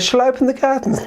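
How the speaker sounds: put-on high, light child voice; a lightly voiced little girl; girl's voice. The girl brings treats to grandmother, high and light.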